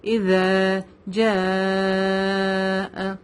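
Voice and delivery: جاء held out for four to five counts; very slow اذا جاء, stretching the vowel of جاء; long madd. Shown as a demonstration. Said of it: "چار سے پانچ حرکہ اس کو لمبا کریں گے". (اذا جاء میں مد کو لمبا کھینچ کر)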